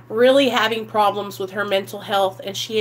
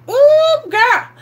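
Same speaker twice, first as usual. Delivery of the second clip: catcalling guys; 9 dB louder than usual. Retold or imitated. Guys catcalling her in hallway.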